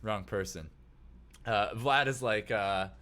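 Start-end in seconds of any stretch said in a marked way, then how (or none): none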